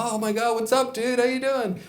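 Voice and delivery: put-on high, eager hello to an old friend; high-pitched excited greeting to a friend. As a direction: greet him like you're thrilled to finally see him. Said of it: "I get all excited".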